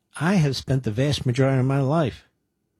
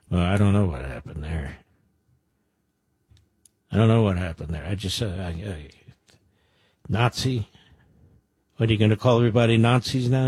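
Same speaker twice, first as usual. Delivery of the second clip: mock flustered guy; 4 semitones lower than usual. Mocking an imagined tattooed candidate.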